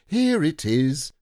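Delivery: high, loud, stretched-out announcement; excited cry. A narrator's reading. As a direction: burst in triumphantly like a delighted child. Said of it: "cried Christopher Robin excitedly".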